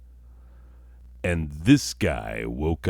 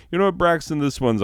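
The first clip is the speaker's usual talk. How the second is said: high, pointed callout of Braxton; mock apology; 11 semitones higher, 4 dB louder, much faster than usual. Real, not acted. Comedian turning to Braxton, voice jumping high. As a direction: call out to Braxton with a raised pitch.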